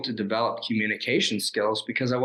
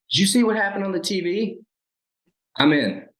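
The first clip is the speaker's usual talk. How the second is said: high, louder, fired-up; retold fervent insistence; 9 semitones higher, 4 dB louder than usual. Teen to recruiter after the attack, higher and louder. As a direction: push it like an angry eager teen.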